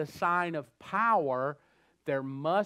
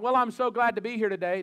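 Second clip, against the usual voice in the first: mock preacher greeting; 6 semitones higher, 6 dB louder than usual. Preacher greeting a crowd through a translator, mock formal. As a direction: play the guest preacher waiting on the interpreter. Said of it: "It's like".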